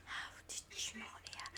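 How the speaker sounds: incredulous hushed whisper; whispering to the bear. Real, not acted. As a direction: whisper in disbelief. Hushed disbelief.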